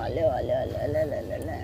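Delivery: low, soft cooing. Real, not acted. Cooing over the pet cat, low and soft.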